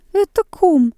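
very high, loud startled cry; cry of recognition. A narrator's reading. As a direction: blurt it out in shock. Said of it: "вскрикнул".

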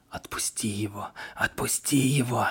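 a ghostly whisper closing in; growing whisper. A narrator's reading. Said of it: "Всё громче и громче".

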